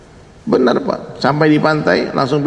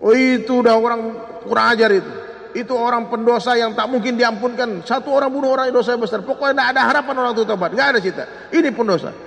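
high-pitched indignant rejection, rattled off; mock a stern cleric condemning a sinner outright; harsh refusal; 9 semitones higher than usual. False cleric rejecting a murderer's repentance, harsh and indignant. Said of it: "Langsung dia sambut".

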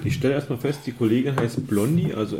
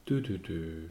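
mock suspense jingle; 6 semitones lower, 10 dB quieter, slower than usual. Gesungene Spannungsmusik.

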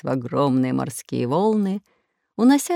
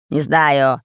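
barking pirate; 5 dB louder than usual. Acted. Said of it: "рявкнул Бульбуль".

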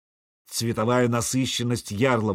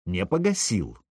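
ordinary delivery

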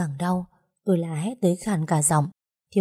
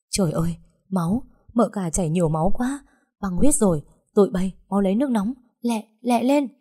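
panicked. Acted. Midwife panics over bleeding.

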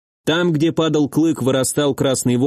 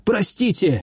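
higher, pleading voice; thin tearful plea; wheezy apology; 6 semitones higher than usual. A narrator's reading. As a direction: beg forgiveness in a pitiful high voice. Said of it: "раздался хрип Гарри из-за щита".

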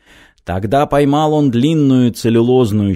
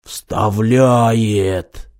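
long, stretched exclamation in an altered voice; mock holler on one drawn-out word; drawn-out cry. A narrator's reading. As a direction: cry it out slowly, without real volume. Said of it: "да как закричит".

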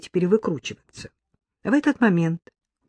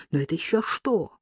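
plain reading voice

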